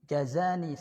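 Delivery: normal talking voice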